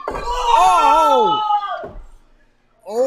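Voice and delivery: loud high gasp of shock; shocked shout. Real, not acted. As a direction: cry out at the impact. Reacts to suplex.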